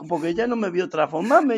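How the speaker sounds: ordinary talk, nothing marked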